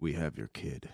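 low quiet villain threat. Shown as a demonstration. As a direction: menace it low and soft. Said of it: "Give me a lead in".

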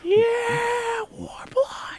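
thin, very high strained cheer, held back in volume; mock crowd cheer. Bandmate cheers the upcoming War Plot shows, high strained mock cheer. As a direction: cheer the band like a tiny faraway crowd. Cheering on their own band.

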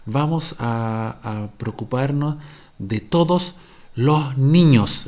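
slow, deliberate mock of a politician hitting every final s; imitating a minister overpronouncing final s. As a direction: drag it out, carefully sounding "preocuparnos", "todos" and "los niños" with a hissed s. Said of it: "tratan de pronunciar todas las eses". (imitando a una ministra que pronuncia forzadamente todas las eses finales)

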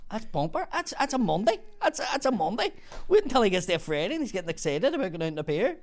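high-pitched, incredulous retelling; imitating amazed disbelief, pitch swooping up; astonished workmate. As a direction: marvel at it in a high voice. Acts out workmates' astonished reaction.

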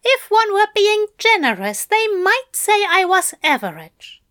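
high, loud fussy Aziraphale; prim Aziraphale. Acted. Aziraphale modestly tells Crowley he is average, high and loud. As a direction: pitch it up and play it prim.

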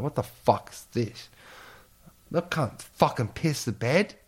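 incredulous rant; outraged. Retold or imitated. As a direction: disbelief at being pissed on. Woken up soaked, outraged.

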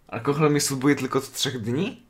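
ordinary talk, nothing marked

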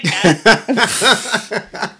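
slow, laughing through the words. Real, not acted. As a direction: dissolve into laughter mid-sentence. Laughing at regret episode idea.

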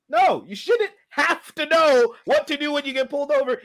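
high-pitched, worked-up argument; heated self-defence; indignant protest. Real, not acted.

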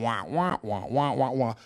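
imitated cartoon babble in short repeated wahs; Peanuts wah-wah voice. Preacher mocks the enemy's talk as Charlie Brown noise, wah-wah imitation. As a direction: go wah-wah like meaningless cartoon chatter. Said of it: "Charlie Brown and the Peanuts gang".

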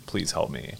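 ordinary talk

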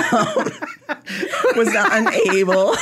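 breaking into high laughter mid-quote; can't get the words out for laughing. Real, not acted. Reading a friend's tweet aloud to a cohost, laughing through it.

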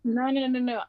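normal talking voice

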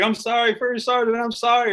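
high-pitched pleading apology, mock tearful; a young private begging his sergeant's pardon, half-mock tearful; imitating crying apology. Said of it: "I'm crying".

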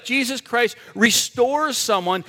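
ordinary delivery

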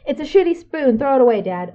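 high, loud, exasperated outburst; Billy Eichner rant. Acted. Friend at home demanding Dad toss the spoon, shrieking rant. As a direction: yell it like a fed-up comic ranting. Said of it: "That was very Billy Eichner of you".

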